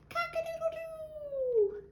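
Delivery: high, drawn-out crow; high rooster call with a long doo. Acted. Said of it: "What does this bird say?".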